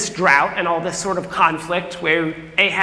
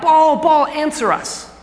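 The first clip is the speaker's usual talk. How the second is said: crying out; 8 semitones higher than usual. Retold or imitated. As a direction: call out to Baal, pleading. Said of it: "they cry out".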